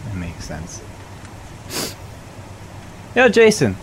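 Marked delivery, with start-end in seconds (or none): 3.2-3.8 calling out. Acted. Calling out to a friend.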